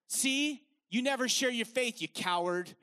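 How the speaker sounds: ordinary delivery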